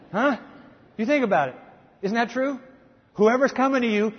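normal talking voice

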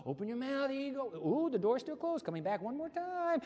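high playful coaxing; sing-song parent to a baby; mama feeding baby. Acted. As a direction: coax a stubborn baby with the spoon. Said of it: "your mama doing this".

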